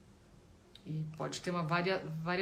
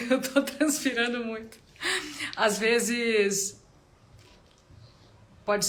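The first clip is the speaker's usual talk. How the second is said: real laughing aside, higher and louder; amused aside; 6 semitones higher, 6 dB louder, slower than usual. Real, not acted. Teacher admits to students they are sweating a lot, amused. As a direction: break off with a laugh, pitch and volume up.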